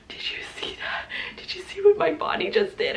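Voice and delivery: stunned breathy whisper rising in pitch; hushed amazement at what your body just did; awed whisper. Retold or imitated. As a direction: whisper it as if you cannot believe it. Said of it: "my face was just like".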